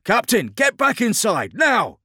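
very high, loud, alarmed command; urgent yell. Acted. Driver yelling at exposed captain, urgent warning. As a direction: scream the warning at full force.

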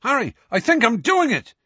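put-on a boy calling across the room, half-yelled; excited yell. A narrator's reading. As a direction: shout it out, thrilled it's working. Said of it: "yelled Seamus".

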